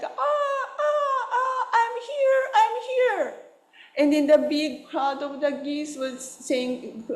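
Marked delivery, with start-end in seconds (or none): 0.0-3.3 very high plaintive cries of I'm here; little goose crying. Retold or imitated. Stranded little goose calls to its flock, very high crying. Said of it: "is also crying like".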